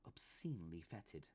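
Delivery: ordinary delivery, nothing marked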